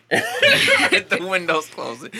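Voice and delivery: slow, laughing echo. Real, not acted. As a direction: laugh it out slowly. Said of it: "the window is closing".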